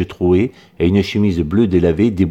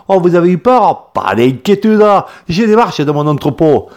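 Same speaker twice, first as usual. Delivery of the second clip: put-on accent du Midi fort et haut, goguenard; il taquine le groupe effrayé; fort accent méridional; 10 semitones higher, 5 dB louder than usual. A narrator's reading. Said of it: "avec un fort accent méridional". (paysan provençal goguenard)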